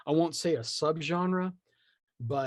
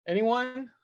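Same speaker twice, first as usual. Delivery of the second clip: mock reluctant volunteering; 6 semitones higher than usual. Host mock-volunteers since nobody writes the book, hammed-up resignation. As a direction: sigh it out like the only one left to do the job.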